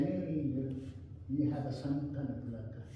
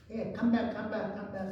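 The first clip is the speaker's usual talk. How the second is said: higher, called out "come back"; calling back; 7 semitones higher than usual. Retold or imitated. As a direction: call across the room to the door. Owner calls him back at door.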